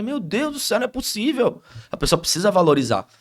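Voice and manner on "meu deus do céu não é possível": much higher, mock-amazed "oh my God, it's not possible"; imitated exasperation. Pastor imitating a newly baptised person: mock disbelief. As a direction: play the disbelieving believer, pitched way up.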